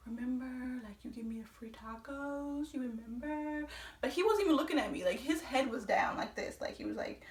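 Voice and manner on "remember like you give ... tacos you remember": soft, hinting undertone; insinuating. Retold or imitated. Customer quietly reminding a friend of free tacos, insinuating. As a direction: drop your voice and hint discreetly. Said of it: "trying to insinuate like".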